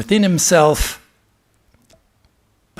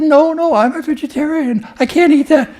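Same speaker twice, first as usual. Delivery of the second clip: much higher, louder refusal with a laugh; squeamish little sheep lion; 11 semitones higher, 8 dB louder than usual. Acted. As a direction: protest like a fussy little lamb. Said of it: "He said".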